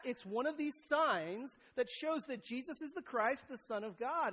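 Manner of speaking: ordinary talk, nothing marked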